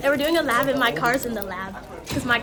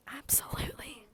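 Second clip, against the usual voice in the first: whisper; 15 dB quieter than usual. Pretend. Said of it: "It's an A S M R".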